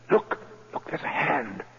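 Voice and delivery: urgent hushed alarm; frightened whisper. Acted. Banker whispering in alarm at the light in the floor. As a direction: whisper it, scared of being heard.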